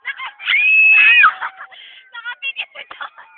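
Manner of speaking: very loud, high, stretched-out yell; excited shriek. Real, not acted. Friend spotting Jonah with eyes closed, gleeful shriek. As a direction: shriek the word and drag it out.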